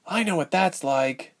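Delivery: ordinary delivery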